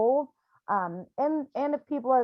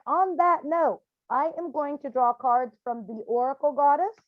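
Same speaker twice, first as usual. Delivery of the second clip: usual speaking voice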